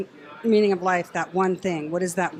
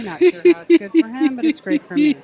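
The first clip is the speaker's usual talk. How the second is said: higher and louder, gleeful; gleeful punchline; 7 semitones higher, 9 dB louder than usual. Real, not acted. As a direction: crow it with delight. Joking punchline about husband.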